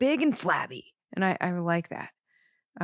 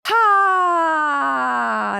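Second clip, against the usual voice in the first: sharp, high, loud yelp; loud high imitated exclamation; mock shout; 9 semitones higher, 9 dB louder than usual. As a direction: belt a sudden cry like the singer. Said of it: "when she says".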